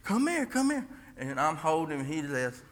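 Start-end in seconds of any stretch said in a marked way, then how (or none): 0.0-0.9 wife calling. Retold or imitated. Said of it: "and Della goes".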